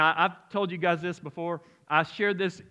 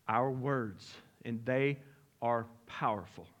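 much softer and lower, letting it sink in; hushed emphasis; 6 semitones lower, 6 dB quieter, slower than usual. Real, not acted. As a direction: drop to a near hush for weight. Preacher drops voice for weight.

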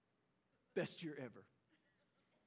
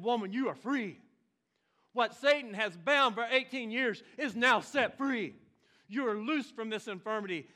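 1 Jesus proclaiming; 4 semitones higher, 8 dB louder than usual. Acted. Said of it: "and he said".

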